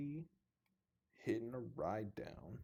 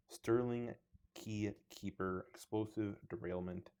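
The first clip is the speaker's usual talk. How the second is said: ordinary talk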